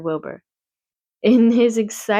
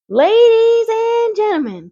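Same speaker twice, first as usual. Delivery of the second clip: high, slow announcer boom over a PA; loudspeaker announcer; 6 dB louder, slower than usual. A narrator's reading. Fair announcer addressing the crowd, booming slow. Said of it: "continued the loudspeaker".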